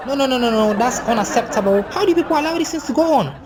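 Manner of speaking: high-pitched, scandalized objection; entitled friend making a fuss; indignant outrage. Retold or imitated. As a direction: protest as if it is intolerable.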